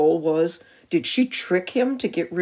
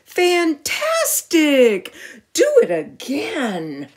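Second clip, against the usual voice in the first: slow, stretched-out excited shout; 8 semitones higher, 4 dB louder, slower than usual. Acted. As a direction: cheer the dragon on loudly. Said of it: "Elizabeth shouted".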